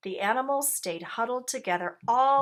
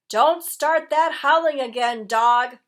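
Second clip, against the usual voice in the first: loud, higher cry; cried out; 5 semitones higher, 11 dB louder than usual. A narrator's reading. Rat yelling at silent dog in the night, loud. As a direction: cry out in the dark. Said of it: "cried Rat".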